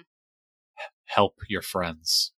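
faint, slow, fading voice; gravely hurt man pleading softly; weak dying plea. Acted. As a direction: barely get the words out, wounded. Dying NPC pleads weakly.

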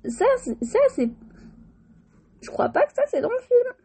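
high-pitched surprise; breaking out of the story, high and amused; surprised. Real, not acted.

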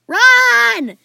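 very loud, high, drawn-out yell; panicked shout. A narrator's reading. Plan B is fleeing the dragon, shouted in panic. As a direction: scream for everyone to flee.

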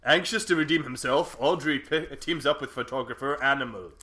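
big, drawn-out title, then dramatic blurb; movie trailer voice. Pretend. Co-host has a go at reading a card, mock movie voice. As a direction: attempt a booming movie-trailer read. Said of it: "I could do it in the movie voice".

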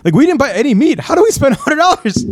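high, rising exasperation; baffled at the checkout total; disbelief. Retold or imitated. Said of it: "I'm like".

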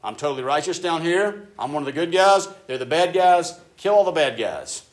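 loud, high, swaggering boast to God; self-righteous believer bragging in prayer. Retold or imitated. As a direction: puff yourself up as one of the good guys. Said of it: "If you get into this place of".

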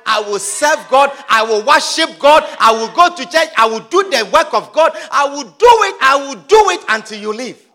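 loud, emphatic vows; acted defiant shouting; angry defiance. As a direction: hammer out each vow at the devil. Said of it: "Be angry at the devil".

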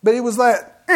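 normal talking voice

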